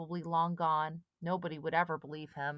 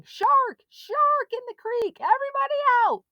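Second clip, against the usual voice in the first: high, loud, fast barked warning; captain shouting from a motorboat; shouted alarm; 11 dB louder than usual. A narrator's reading. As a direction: clear the water with an alarm. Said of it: "Captain Wilson's voice shouting".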